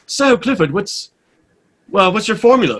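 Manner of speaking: high, put-on inquiring voice; station manager. Station manager asking the new host his formula, put-on voice. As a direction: lift the voice into a lofty question.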